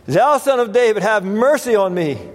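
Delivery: insistent, high-pitched plea. Retold or imitated. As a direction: call out persistently for mercy. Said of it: "cried the more a great deal".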